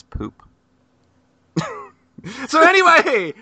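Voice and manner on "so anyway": loud reset. Real, not acted. Host resets to interview.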